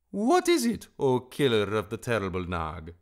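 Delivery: high, loud grandiose bird; singsong heroic address; Darzee. A narrator's reading. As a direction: open very high, then ease down grandly.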